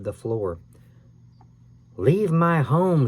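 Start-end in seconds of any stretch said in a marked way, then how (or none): 2.0-3.1 slow, drawn-out ghostly snarl, higher and louder; a ghost growling at intruders. A narrator's reading. Ghost snarls at the boys: slow menacing snarl. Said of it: "she snarled".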